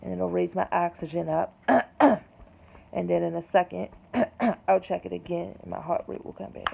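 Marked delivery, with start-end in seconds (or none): 1.6-2.9 loud chesty cough; a real, painful cough; harsh cough. Real, not acted. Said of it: "This is what I have to go through". 3.9-4.6 quick loud cough; short cough. Real, not acted. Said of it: "This is what I have to go through".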